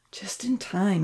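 soft, half-whispered thought; thinking to oneself. A narrator's reading. Uncle Louie spots the scissors, thinking softly. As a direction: say it quietly to yourself. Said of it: "he thought".

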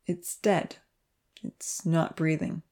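slow, soft and heavy; sombre, quiet pronouncement. A narrator's reading. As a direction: let the news weigh on each word. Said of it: "he spoke heavily".

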